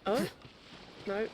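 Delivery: ordinary talk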